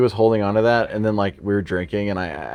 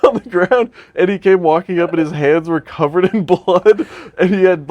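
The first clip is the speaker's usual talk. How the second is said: laughing; 7 semitones higher, 4 dB louder than usual. Real, not acted. Laughing through the bloody story.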